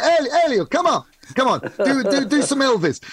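high, eager coaxing call; family urging Elio to do a song; coaxing relative. Retold or imitated. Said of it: "that's exactly it".

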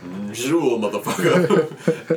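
loud, drawn-out whale voice, laughing; hammed-up whale greeting. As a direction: boom it out slowly as the whale, cracking up. Voicing whale while laughing.

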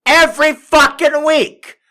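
high, loud fed-up shout; exasperated yell. Acted. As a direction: blow up at the show. Comic rant peak.

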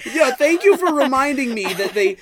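high, loud, mock-grateful; hammed-up sarcastic gratitude; loud sarcastic thanks. Real, not acted. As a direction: exclaim it with mock indignation.

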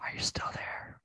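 whispered late night phone call; hushed, teasing whisper down the line; giggly late night call. Pretend.

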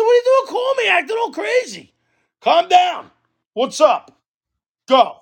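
high-pitched, exasperated comeback; indignant retort. Retold or imitated. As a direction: act out telling an irate neighbor off. Retold quarrel, talking back to neighbor.

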